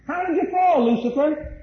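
preaching cry of How did you fall; preacher's cry. Acted. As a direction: cry the question out to the congregation. Preacher cries out the question.

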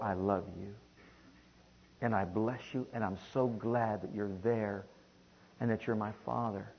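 soft, low, tender confession; gentle, grateful prayer; child approaching father. Shown as a demonstration. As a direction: speak intimately to a loved father. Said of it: "as a child approaching a father".

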